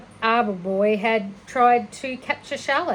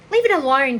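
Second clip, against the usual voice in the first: commanding; 5 semitones higher, 7 dB louder than usual. Acted. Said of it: "commanded Fern".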